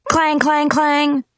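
metal clang, high; clanging sound. A narrator's reading. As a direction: ring out like a sword on bars. Sword striking dungeon bars.

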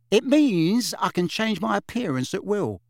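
a hint of a breezy young witch; lightly voiced witch. Witch explaining to puzzled Harry, lightly breezy.

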